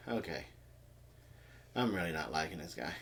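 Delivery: low, very soft, quickened aside; muttered thought. Retold or imitated. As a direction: mutter it under your breath. Retold private thought on the table.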